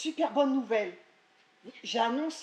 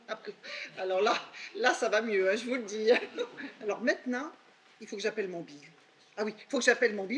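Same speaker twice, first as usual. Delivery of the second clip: ordinary talk, nothing marked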